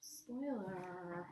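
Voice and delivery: plain reading voice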